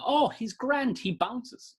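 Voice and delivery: very high, airy and unbothered; breezy high parent voice. Retold or imitated. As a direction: brush it off like a relaxed parent. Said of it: "you see".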